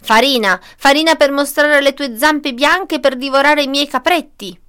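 loud, indignant accusation; angry mother goat. A narrator's reading. Mother goat suspects the wolf's trick, indignant.

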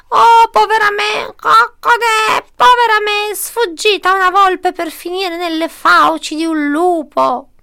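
high, loud, drawn-out squawking lament; wailing hen. A narrator's reading. Rescued hen now faces a wolf, panicked wail.

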